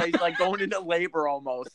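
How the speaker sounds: usual speaking voice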